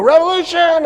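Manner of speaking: high, loud rallying yell; a crowd roaring the one word back; mob shout. Acted. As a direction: bellow it like a rioting crowd. French mob answers the leaders.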